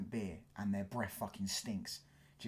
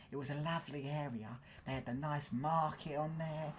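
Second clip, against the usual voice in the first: lightly imitated nan, higher-pitched; nostalgic nan; 5 semitones higher than usual. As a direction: reminisce fondly like someone's nan, pitched up. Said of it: "My nan told me".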